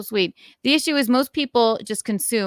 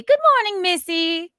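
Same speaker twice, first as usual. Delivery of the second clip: much higher, playful good morning; sing-song greeting; 11 semitones higher, 6 dB louder than usual. Real, not acted. Streamer greeting a viewer in a sing-song voice.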